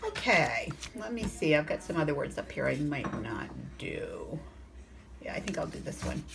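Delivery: low-volume, half-whispered aside to oneself; real quiet self-talk; thinking aloud. Real, not acted. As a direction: murmur it softly as if deciding privately. Teacher thinking aloud to herself.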